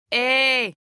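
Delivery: calling across the water. A narrator's reading. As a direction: hail the boat. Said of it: "called the woman".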